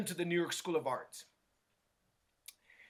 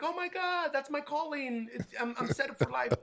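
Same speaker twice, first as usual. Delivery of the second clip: higher, starry-eyed mock joy; mock elation; 8 semitones higher than usual. Student imagining his reaction to the angel, higher mock elation. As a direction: gush like you found your calling. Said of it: "And I'd be like".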